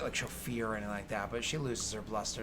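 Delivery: ordinary talk, nothing marked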